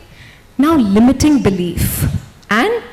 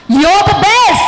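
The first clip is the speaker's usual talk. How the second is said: high, loud cheer; rousing affirmation; 8 semitones higher, 5 dB louder than usual. Shown as a demonstration. Coach cheering the volunteer to reprogram her. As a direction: pump her up. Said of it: "let's reprogram her".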